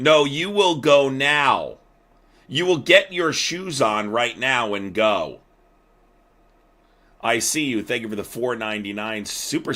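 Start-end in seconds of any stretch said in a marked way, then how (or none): 0.0-5.4 loud, slow commanding yell. Real, not acted. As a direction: bark the order, drawn out and loud. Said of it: "so that you can yell at me like that".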